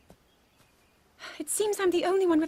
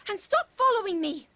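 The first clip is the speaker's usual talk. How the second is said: annoyed; 5 semitones higher than usual. Acted. Princess scolds knight trailing her.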